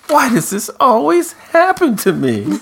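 high-pitched hammed-up lament; mock dismayed wail. Podcaster mimicking woman who sees a kiss, mock wail. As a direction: wail it like a soap heroine. Retold character's dismayed complaint.

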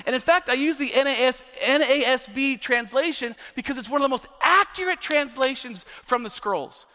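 ordinary talk

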